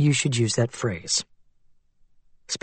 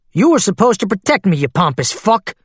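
angry outburst; 6 semitones higher than usual. Acted. Client standing up to curse his lawyer, angry outburst. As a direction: lash out, standing up, at the man who failed him. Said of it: "these outbursts are on the record".

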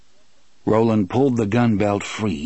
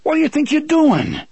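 high, fast, heated outburst; agitated demand; 5 dB louder, faster than usual. Acted. Eddie confronting Roland over the gun, agitated. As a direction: demand it in a pitched-up rush.

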